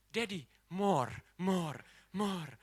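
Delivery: delighted little daughter. Retold or imitated. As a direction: plead gleefully like a five-year-old. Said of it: "ketawa-tawa, dia berkata segini".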